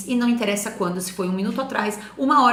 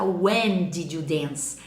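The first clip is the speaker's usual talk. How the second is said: slow, low, careful question; slow model question; 5 semitones lower, much slower than usual. Shown as a demonstration. Teacher models a question slowly for the students. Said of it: "vai ter que perguntar".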